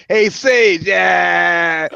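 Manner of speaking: called out loud from a passing car; loud mocking hail at a fighter; shouted greeting. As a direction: yell his name across the street. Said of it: "I said".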